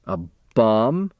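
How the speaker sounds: plain reading voice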